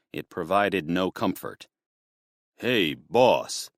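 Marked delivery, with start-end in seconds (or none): 2.6-3.7 chattering thug. A narrator's reading. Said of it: "the man on the line chattered".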